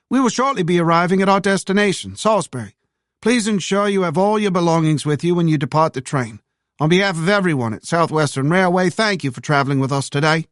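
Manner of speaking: tinny railway announcement voice; train announcer. Acted. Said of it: "the nasal voice of the conductor on the public address system".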